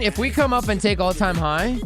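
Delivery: usual speaking voice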